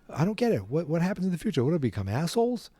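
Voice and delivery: high, puzzled mock protest; lightly hammed-up confusion; confused questioning. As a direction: act out a bewildered kid firing questions. Acts out Marty questioning Doc.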